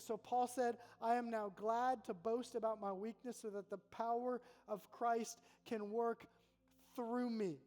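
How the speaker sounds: loud, slow scripture proclamation; slow, forceful preacher reading with heavy pauses; preaching at full force. Acted.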